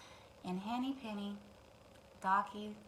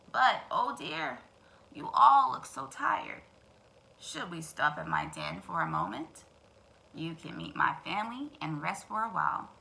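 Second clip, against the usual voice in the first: sly fox; 3 semitones lower than usual. Acted. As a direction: lure them sweetly into the den. Fox invites them to den.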